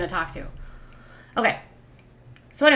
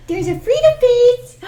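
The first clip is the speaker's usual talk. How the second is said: louder higher coo; 7 dB louder than usual. Real, not acted. Owner gushing at her puppy, high and louder. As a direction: really gush over the dog.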